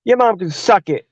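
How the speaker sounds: high, scoffing brush-off; a mock bratty kid; dismissive kid. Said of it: "He's like".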